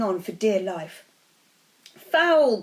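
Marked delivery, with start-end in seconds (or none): 1.9-2.6 high, loud outraged shout; screamed protest. A narrator's reading. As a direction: cry foul like an angry crowd. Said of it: "screamed the Gryffindors".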